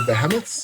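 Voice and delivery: "Behemoths" said as "BEH-uh-muts"; jokey regional pronunciation. Shown as a demonstration. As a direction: stress the start of "Behemoths", with a short middle. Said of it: "up in Kanakenstein we say".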